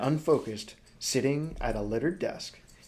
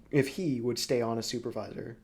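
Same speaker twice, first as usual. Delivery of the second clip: ordinary talk, nothing marked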